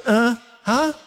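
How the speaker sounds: high innocent "Uh, huh?" as if not hearing; playing dumb. Boy playing deaf to his mother, innocent "huh". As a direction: feign not hearing the chore. Acting out kid dodging mom.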